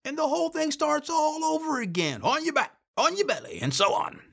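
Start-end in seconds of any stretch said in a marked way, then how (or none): 2.2-3.6 raised, mock commander's calls; retold drill orders. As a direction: rattle off orders, lightly imitating the commander. Commander's orders repeated in retelling.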